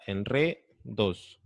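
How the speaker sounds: "en re, dos" slowly, pausing before "dos"; word broken up. Shown as a demonstration. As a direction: split off the ending: "en re... dos". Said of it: "Su sonido final es edos". ("en re, dos" pausado, separando la última sílaba)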